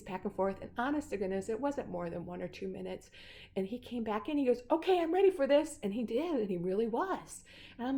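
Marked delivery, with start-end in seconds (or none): 4.7-5.8 high, loud retold announcement; eager readiness. Nick returning from the hall, eager. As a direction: imitate someone coming back all fired up.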